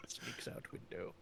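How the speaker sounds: whisper. Acted. Reads stage direction while sneaking.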